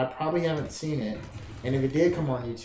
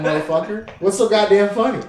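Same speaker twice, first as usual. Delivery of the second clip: loud, high mock outrage; hammed-up indignant outburst; mock irritation; 6 semitones higher, 9 dB louder than usual. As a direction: demand it loudly, pretending to be fed up. Mock annoyance at others laughing online.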